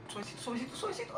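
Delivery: high, soft, climbing plea; tense coaxing. Real, not acted. Player nursing the ball along, tense soft coaxing. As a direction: coax it in a tiny nervous voice.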